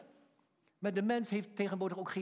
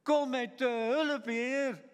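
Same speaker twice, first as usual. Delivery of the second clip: loud, high, slowly drawn-out plea; pleading confession; 6 semitones higher, 3 dB louder, much slower than usual. Acted. Blind man begging the Son of David for help, pleading cry. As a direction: beg for mercy, stretching every word. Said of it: "En dan zijn belijdenis".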